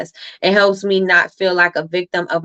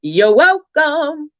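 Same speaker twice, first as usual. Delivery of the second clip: slow, high, loud showy "You're welcome."; swaggering, drawn-out "You're welcome."; Maui-style you're welcome; 6 semitones higher, 3 dB louder, much slower than usual. Shown as a demonstration. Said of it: "I say it just like that".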